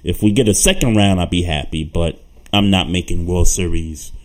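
normal talking voice

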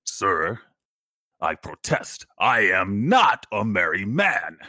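indignant, slow and weighty protest; Worf. Acted. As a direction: declaim it with offended dignity, measured pauses, punching the last phrase. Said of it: "he's just, like, indignant".

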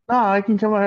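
high-pitched, breezy refusal; oblivious friend. Retold or imitated. Underage friend refusing to go back inside, oblivious. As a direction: imitate a clueless friend in a high voice.

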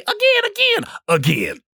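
mock Teletubby chant, first again squeaked high; a hint of a childish Teletubby; Teletubbies voice. Quoting the Teletubbies about wiping the drive, mock childish chant. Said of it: "Like what the Teletubbies used to say".

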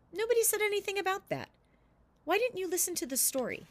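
put-on a playful donkey needling the boy; teasing donkey voice. Donkey Milly Tinkle teasing Tol, high and quick.

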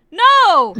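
loud high mock yell; outraged shout. Korra hearing Kagome's breasts are nicer, loud mock yell. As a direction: explode with a shocked yell.

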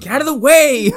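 high, urgent mock shout; mock warning yell. Warning someone in the path, mock yell.

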